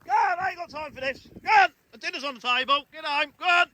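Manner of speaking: high calling out like a parent; calling kids to dinner. Pretend. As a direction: call them home like kids for dinner. Calling cows home, dinner on.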